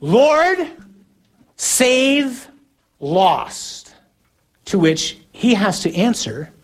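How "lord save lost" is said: high, drawn-out shout, word by word; long hollered words with gaps between; screamed over a storm. Shown as a demonstration. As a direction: call out desperately across a roaring storm. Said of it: "here's how you have to say it".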